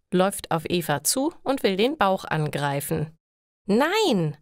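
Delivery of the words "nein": angry no. Acted. Eva refuses her friend touching her belly, angry. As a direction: stop her hand with a firm angry no. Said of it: "sagt Eva wütend".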